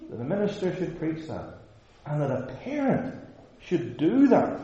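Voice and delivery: put-on indignant dismay; scandalized objector. Imagined objector continues the outrage.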